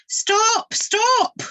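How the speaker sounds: high, loud cry to stop the bird; alarmed cry. Acted. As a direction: shout after the escaping bird. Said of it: "cried the ornithologist".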